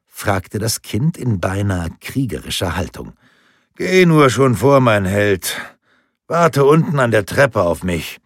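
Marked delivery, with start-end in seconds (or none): 3.8-8.2 put-on louder, higher cheerful old man sending him off; fond grandfather. Grandfather sends his grandson ahead to wait, cheerful fond voice. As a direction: wave the little hero ahead.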